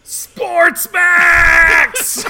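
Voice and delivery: very high, very loud drawn-out yell; hammed-up scream. Avenger screams at sister's killer, hammed-up rage. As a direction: scream your enemy's name, stretching the end. Enraged character screams villain's name.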